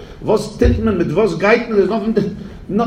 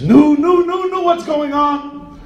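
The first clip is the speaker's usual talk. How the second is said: alarmed; 9 semitones higher than usual. Retold or imitated. The Poritz alarmed at the dog.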